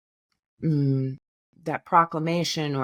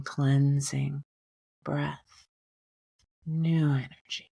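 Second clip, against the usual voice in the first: gently guiding a breath meditation, unhurried; guided meditation; 3 dB quieter than usual. Acted. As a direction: ease the listener into calm breathing. Leads a meditation.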